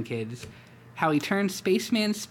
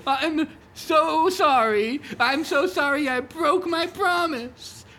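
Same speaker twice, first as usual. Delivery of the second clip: much higher, slow, sobbing apology; 13 semitones higher, 6 dB louder, slower than usual. Acted. Old man to his tiger, sobbing and sorry. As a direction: break down sobbing to your friend. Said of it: "broke down and sobbed".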